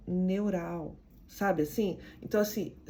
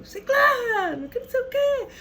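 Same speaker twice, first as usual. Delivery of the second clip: family shouting; 6 dB louder than usual. Retold or imitated. Imitating a shouting family calling a relative, loud and high with a laugh. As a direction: holler the name across the house, half laughing.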